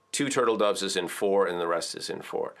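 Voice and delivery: usual speaking voice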